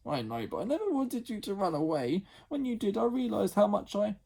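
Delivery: a big sister saying sorry; sorry older sister Jemima. Acted. Jemima voiced in story.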